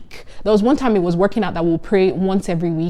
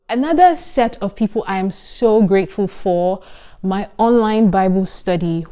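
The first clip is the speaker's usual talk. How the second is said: ordinary talk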